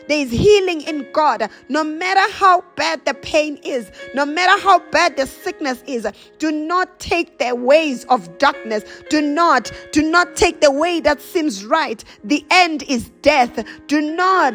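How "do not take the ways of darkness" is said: ordinary talk, nothing marked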